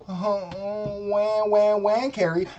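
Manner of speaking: whiny mocking wah wah; mock crying wah. Mocking Carrie as a crybaby, whiny wah wah. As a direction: sneer it like a crybaby.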